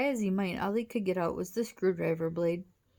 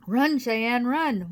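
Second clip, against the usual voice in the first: louder, higher cry of alarm; urgent shout; 4 semitones higher, 7 dB louder, slower than usual. A narrator's reading. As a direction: shout a warning to flee. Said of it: "Wally shouted".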